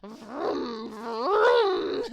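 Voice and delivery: laughing car noises; giggly vroom. Acted. Skull makes car noises while laughing.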